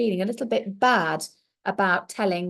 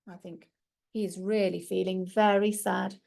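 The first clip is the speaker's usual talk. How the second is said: usual speaking voice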